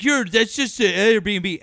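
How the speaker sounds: high, loud, drawn-out mock protest; Liam. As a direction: imitate a whiny accuser, yelling slowly. Retells Liam's protesting accusation.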